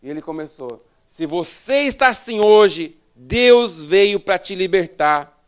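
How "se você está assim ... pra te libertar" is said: loud, drawn-out declaration; ringing pulpit announcement; preacher's proclamation. Retold or imitated. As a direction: proclaim it slowly and forcefully.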